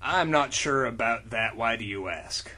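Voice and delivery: low, quiet, fast brooding hero; low, soft, quick tough-guy read; John Constantine voice. Acted. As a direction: mutter it like a weary detective. Reads character lines in low voice.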